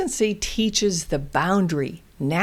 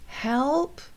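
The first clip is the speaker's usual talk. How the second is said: gentle modeled word; 6 semitones higher, 5 dB quieter than usual. Shown as a demonstration. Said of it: "Maybe show a gesture".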